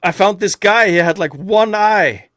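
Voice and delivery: put-on high, loud eager kid voice; playing his eager younger self; mock excited boast. Boasting to a new friend, high and eager. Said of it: "I'm like".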